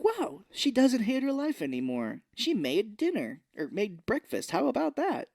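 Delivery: family mocked as fake impressed. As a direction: overdo the family's amazement. Mocking family praise.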